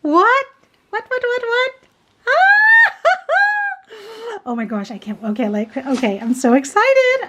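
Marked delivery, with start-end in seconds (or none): 0.0-1.7 high, rapid disbelieving what-what; can't believe what she's seeing; stunned disbelief. Real, not acted. Stunned by a gift. 2.3-3.7 high shriek of joy breaking into a laugh; a real overjoyed scream-laugh; delighted squeal. Real, not acted. Squeals at the gift.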